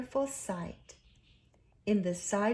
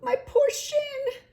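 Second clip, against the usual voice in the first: high, loud, slow pained wail; high loud crying whimper; 8 dB louder, slower than usual. A narrator's reading. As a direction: moan it out, hurt and pitiful. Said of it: "he cried".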